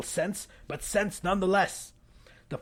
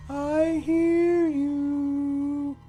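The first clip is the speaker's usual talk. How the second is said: high, slow sing-song; slow eerie high coaxing call; taunting monster call; 9 semitones higher, much slower than usual. Acted. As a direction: call out sweetly, hunting them. Estella hunting them.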